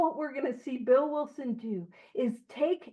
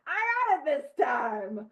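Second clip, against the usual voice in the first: tipsy patient; 6 semitones higher than usual. Retold or imitated. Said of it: "he's like".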